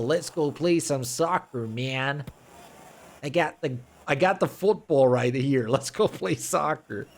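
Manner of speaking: put-on a light American bro accent, drawn out on "soccer"; mock American bro. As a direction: parody American kids calling friends to play. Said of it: "Roasting the U S".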